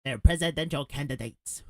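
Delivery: exaggerated mocking echo of the other man; mocking imitation. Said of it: "Who talks like that?".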